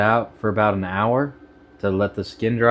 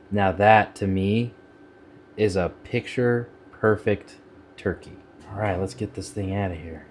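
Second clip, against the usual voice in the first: normal talking voice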